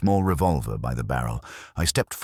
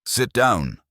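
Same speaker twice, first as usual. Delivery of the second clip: sharp, louder, higher bark; barked order; 8 semitones higher, 5 dB louder than usual. A narrator's reading. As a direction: snap the order at them. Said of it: "Talbot barked".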